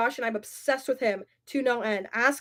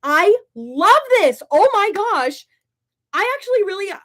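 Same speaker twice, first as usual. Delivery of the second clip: delighted; 7 semitones higher, 7 dB louder than usual. Real, not acted. Delighted by a comment.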